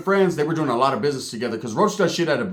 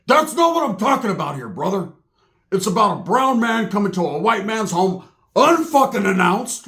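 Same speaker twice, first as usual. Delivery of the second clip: high, indignant imitation of a ranting man; indignant mock rant; 4 semitones higher than usual. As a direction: hammer it out, drawing out the last words. Acting out Roach's indignant rant.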